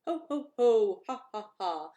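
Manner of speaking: loud mocking ho ho ho; carefree pig laughter; laughing pigs. A narrator's reading. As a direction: laugh it out carelessly. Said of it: "laughed the two lazy little pigs".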